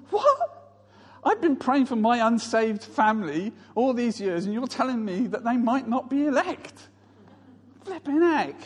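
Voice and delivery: high, indignant mock shock; appalled disbelief. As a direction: squeal the question, then protest in dismay. Acts his shocked thought at roommate.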